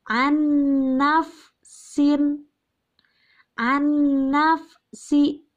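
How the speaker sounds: "an-nafsi" stretched slowly, doubled nn; slow recitation demo. Shown as a demonstration. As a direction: sound out "an-nafsi" syllable by syllable. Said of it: "Ada kata". (pelafalan "an-nafsi" yang lambat)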